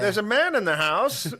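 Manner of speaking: high, loud alarmed call; mock alarmed cry. Wife warning her husband, mock alarmed cry. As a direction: raise the alarm, half joking. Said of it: "couple spotting the intruder".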